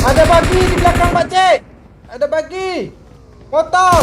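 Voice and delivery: loud urgent callout; shouting. Real, not acted. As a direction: yell the warning to your teammate. Warning teammate of enemy behind.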